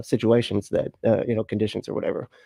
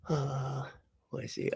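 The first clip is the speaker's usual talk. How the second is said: usual speaking voice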